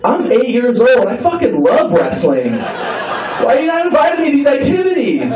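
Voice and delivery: hammed-up eight-year-old outburst; indignant excited kid. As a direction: whine up high at being left out. Excited, indignant child in retold scene.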